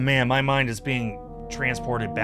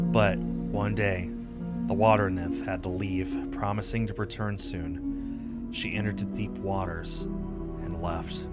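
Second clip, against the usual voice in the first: measured, slightly lower story narration; legend storytelling; 4 semitones lower, 5 dB quieter than usual. Acted. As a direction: tell the old legend in an even, unhurried voice.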